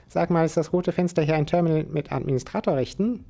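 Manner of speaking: a hint of a cheeky squirrel; squirrel Spike reporting. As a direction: report like a little agent robot.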